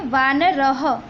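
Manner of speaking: वानरः said as vaanaraha, the final ha audible; visarga ending. Shown as a demonstration. Teacher to students, modelling the visarga sound. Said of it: "इसको कहेंगे".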